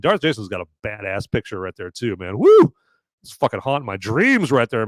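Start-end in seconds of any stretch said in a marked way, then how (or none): 2.4-2.7 excited whoop. Real, not acted. Excited cheer.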